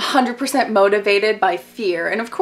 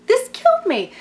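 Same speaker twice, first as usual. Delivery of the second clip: much higher, gushing squeal; squealing repeat; 4 dB louder than usual. Real, not acted. Vlogger repeats her reaction to the father's line, squealing. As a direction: squeal it out, delighted and aghast. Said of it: "oh my God, this killed me".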